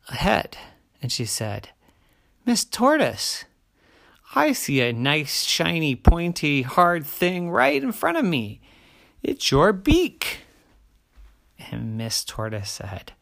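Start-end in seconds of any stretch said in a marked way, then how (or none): 2.4-10.4 high, eager discovery; excited bear voice. Acted. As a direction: bear lighting up with an idea. Bear spots the beak.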